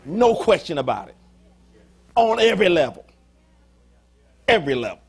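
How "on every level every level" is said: loud, forceful repetition; preaching emphasis. Real, not acted. Preacher hammering the congregation, loud and forceful. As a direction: drive the point home to the congregation.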